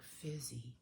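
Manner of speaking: low, very soft single word; faint dazed answer. Retold or imitated. Husband answering after passing out, faint dazed answer. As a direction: let it out weakly, barely voiced. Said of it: "he just answers me like".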